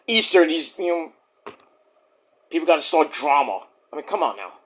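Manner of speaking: ordinary talk, nothing marked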